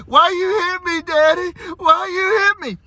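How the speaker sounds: put-on high whiny wail of a kid faking hurt; fake crying child. Daughter on the floor fake-whining at her dad. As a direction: whimper it like a little girl putting on tears. Daughter fakes being hit.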